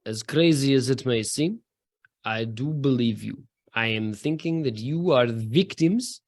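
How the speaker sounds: lightly done Russian mercenary voice, low and unhurried; a hint of a Russian swordsman, slow and measured; understated Russian accent, calm and deliberate. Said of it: "says in his very good Russian accent".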